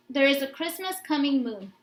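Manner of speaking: ordinary delivery, nothing marked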